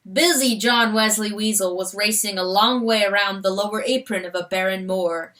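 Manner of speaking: usual speaking voice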